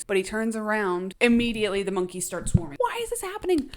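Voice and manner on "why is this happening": very high, frantic exclamations; reacting to the monkey swarm with hammed-up high-pitched panic; mock panic. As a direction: squeal in hammed-up alarm.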